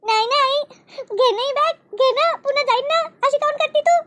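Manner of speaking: put-on a little girl close to tears; crying child. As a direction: daughter cries to make her father buy the bag. Child starts crying for bag.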